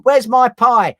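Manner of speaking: high, loud panto simpleton voice; Simple Simon. Retold or imitated. Recalling his panto role, a loud, high Simple Simon cry. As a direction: squeal it like a panto fool. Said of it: "I was playing Simple Simon".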